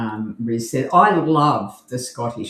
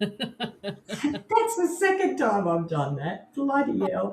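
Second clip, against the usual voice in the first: high, slow, through laughter; laughing realisation; 7 semitones higher, 4 dB quieter, slower than usual. Real, not acted. Co-host caught doing the rise, helplessly amused. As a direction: squeal it out while laughing at yourself.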